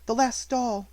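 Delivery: put-on grieving child repeating herself; mournful, odd. A narrator's reading. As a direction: let it sound oddly hollow. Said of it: "little mournful voice had an odd sound".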